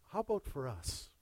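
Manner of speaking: ordinary talk, nothing marked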